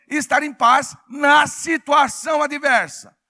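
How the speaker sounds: preaching shout. Real, not acted. Preacher building to full force.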